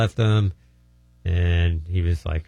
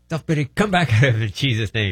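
firm, raised command to a spirit; casting-out command; 5 semitones higher than usual. Retold or imitated. Minister again commanding self-pity out of the man. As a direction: rebuke the spirit with authority. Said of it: "And I said".

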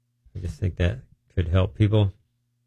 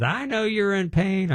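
high, slow, cooing nurse voice; sympathetic nurse; 13 semitones higher than usual. Retold or imitated. Nurse telling the burned patient she knows it hurts, high slow coo.

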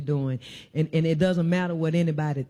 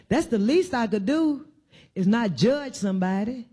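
preaching climb; 5 semitones higher than usual. Real, not acted. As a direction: voice climbing steadily higher to a near cry. Sermon climb to high pitch.

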